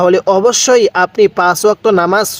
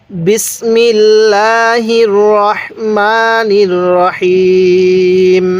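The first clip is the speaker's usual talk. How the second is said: very slow, sustained recitation on a level pitch; measured Bismillah recitation; Quran recitation; much slower than usual. Acted. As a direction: draw out each long vowel reverently. Opens with drawn-out recitation.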